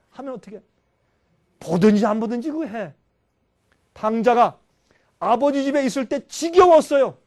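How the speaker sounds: normal talking voice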